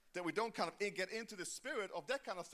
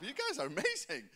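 ordinary delivery

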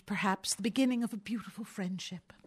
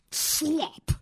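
a sharp angry retort, pitched up; 7 semitones higher than usual. A narrator's reading. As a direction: spit it out in disgust. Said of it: "said the citizen angrily".

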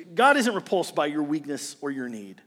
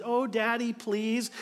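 put-on high, soft little-girl plea; mock pleading daughter; 5 semitones higher, 4 dB quieter, slower than usual. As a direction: coax daddy sweetly, a bit higher. Daughter voiced pleading dad.

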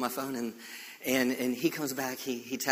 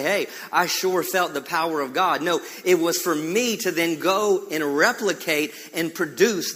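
loud, raised preaching voice; full-force sermon delivery with drawn-out emphasis; fervent preaching; 6 semitones higher, 6 dB louder than usual. Real, not acted. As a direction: drive it home to the congregation, leaning on key words.

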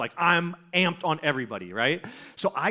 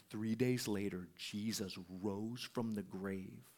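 slow, soft, lowered storytelling; hushed reveal; 7 semitones lower, 13 dB quieter, slower than usual. Retold or imitated. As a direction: let it out slowly and very quietly, as a retold revelation. Retold solemn reveal to the skater.

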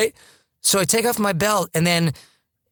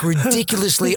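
ordinary talk, nothing marked

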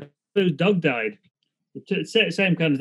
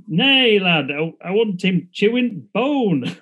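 louder, slower broad Yorkshire; Yorkshire punchline; 3 semitones higher, 4 dB louder, slower than usual. Retold or imitated. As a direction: deliver 'Nay, lad' in a hearty Yorkshire accent. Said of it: "all mocking the Yorkshire dialect".

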